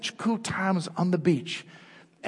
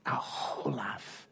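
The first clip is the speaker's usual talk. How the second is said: whispered repeat; 6 semitones lower, 9 dB quieter, slower than usual. Retold or imitated. Dad's quote repeated, whispered.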